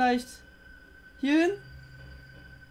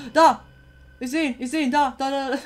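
excited shouts; 3 semitones higher, 6 dB louder than usual. Real, not acted. Spotting the animatronic on camera.

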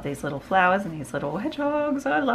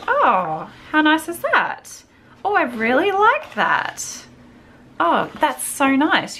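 much higher, louder and slow, cooing over it; delighted gushing; 11 semitones higher, 5 dB louder, slower than usual. Real, not acted. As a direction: swoon over the paper. Delighted at a tag paper.

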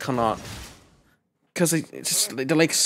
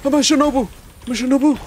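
high, loud thrilled cry; excited cry; 13 semitones higher, 4 dB louder, faster than usual. Real, not acted. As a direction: cheer like your hero just won. Cheering for his character.

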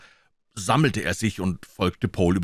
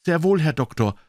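higher, loud, a touch triumphant; 8 semitones higher, 3 dB louder than usual. A narrator's reading. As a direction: answer loudly, with a hint of triumph. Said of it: "sagte Paul mit lauter Stimme".

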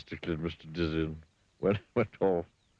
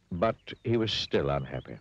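ordinary delivery, nothing marked

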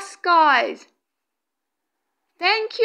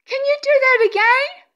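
high, loud, thrilled exclamations; excited; 8 semitones higher, 4 dB louder than usual. Real, not acted. Medium thrilled by a bright orb, excited. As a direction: burst out amazed at what you're seeing. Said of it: "I'm so excited".

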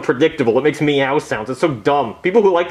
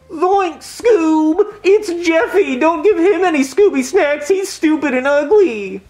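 higher, excited cartoon sidekick; Shaggy-style cartoon voice; 12 semitones higher, 3 dB louder, slower than usual. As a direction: yelp it like a startled cartoon slacker. Scooby-Doo cartoon character voice.

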